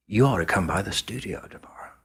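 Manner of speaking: a real whisper, no voice in it; whispered invite. Retold or imitated. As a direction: whisper the invitation across the bar. Drunk [name]'s late-night invitation.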